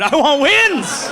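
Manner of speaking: high, loud, drawn-out yell; fervent mock outburst. Would-be dad telling clinic lady his demand, drawn-out high shout. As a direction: shout it slowly, stretching the last word.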